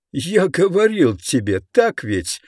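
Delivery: high, crowing I-told-you-so; triumphant shout. Acted. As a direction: gloat it out gleefully. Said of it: "кричал Мууди".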